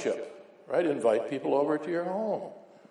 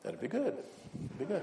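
quiet reply; 5 semitones higher, 10 dB quieter than usual. Retold or imitated. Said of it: "preacher answering".

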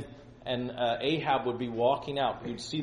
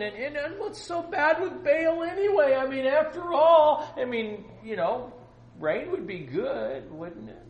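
high-pitched rationalizing; 11 semitones higher, 6 dB louder than usual. Acted. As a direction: voice pushed high, pleading an excuse. Acts out compromiser excusing Baal worship.

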